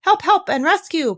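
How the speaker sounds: high, loud cry for help. Acted. Piglet crying for rescue in the poem, loud. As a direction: call out for rescue. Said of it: "Piglet cried".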